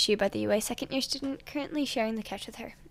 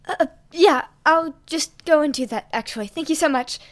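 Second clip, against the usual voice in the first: flustered stammer; 5 semitones higher, 7 dB louder than usual. Acted. Flustered boy answering.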